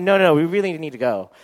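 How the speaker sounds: loud, pressing protest; urgent insistence. Retold or imitated. Boyfriend needs her at the surprise party, urgently insistent. Boyfriend pushing back in quarrel.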